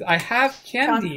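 high, slow hammed-up enticement; drawn-out, high-pitched mock coaxing; coaxing a child. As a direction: tempt a little girl with treats. Men coax girl with sweets.

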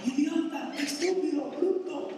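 put-on high-pitched yelled insults at a child; mock yelling at kids. Parent screaming insults at their children in anger. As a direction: yell the insults like a parent losing it. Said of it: "agarramos a nuestros hijos y les gritamos".

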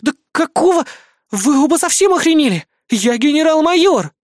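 high, loud indignant outburst; outraged. A narrator's reading. Major general protesting the child seat, outraged. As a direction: pull rank in shrieky girlish outrage.

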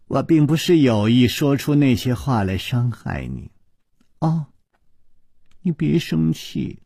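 coy and soft, with a little laugh; soft coquettish voice. A narrator's reading. 宫南燕向楚留香娇声道歉，soft and coy. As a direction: sweet-talk him playfully, apologizing coyly. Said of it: "娇笑起来，柔声说".

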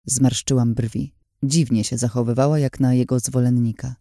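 ordinary delivery, nothing marked

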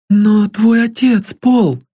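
put-on high, eager young girl pleading sweetly; a lovesick girl dreaming of a home; high girlish voice full of hope; young girl Sylvia. A narrator's reading.

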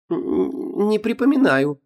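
high, stammering, unsure voice; stammering young woman. Acted. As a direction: stumble over it up high.

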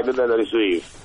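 normal talking voice

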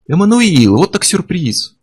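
high, stretched sarcastic greeting; mock surprise. A narrator's reading. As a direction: pitch it up in feigned delight. Курт с ходу идёт в атаку. (притворное удивление)

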